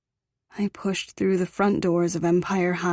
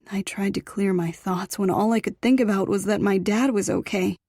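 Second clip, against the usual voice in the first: usual speaking voice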